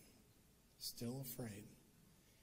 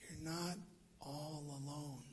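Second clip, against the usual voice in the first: tender reassurance; 5 dB quieter than usual. Acted. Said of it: "you know what God's saying".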